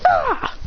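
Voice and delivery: high martial-arts yell; acted high attack shout; kick cry. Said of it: "with a kick, going".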